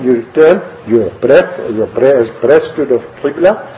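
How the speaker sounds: usual speaking voice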